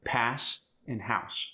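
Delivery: ordinary delivery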